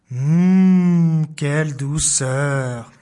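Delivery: low, very slow contented murmur; blissful sigh. Acted. Mouse settling in the mitten, slow and blissful. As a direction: sink into the softness. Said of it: "La souris est ravie".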